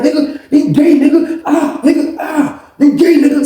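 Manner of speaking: high, loud hammed-up yelping; taunting under blows. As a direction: act out yelps between punches. Acted insults while being pounded.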